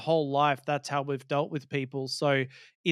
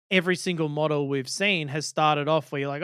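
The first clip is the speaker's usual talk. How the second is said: ordinary talk, nothing marked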